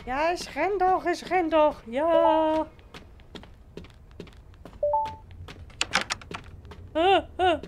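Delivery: high, loud panicked shouting; being chased. Real, not acted. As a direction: yell in panic as it closes in. Panicked while chased in game.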